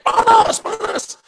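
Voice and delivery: high, loud mock scream of burning; possessed man shrieking that he is burning; screaming in pain. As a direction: cry out as if scorched. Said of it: "Terus dia teriak".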